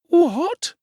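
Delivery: high, loud startled woman's what; nearly shrieking the word; shocked exclamation. A narrator's reading. Mrs Sowerberry startled by Bumble, loud and high. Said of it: "exclaimed Missus Sowerberry".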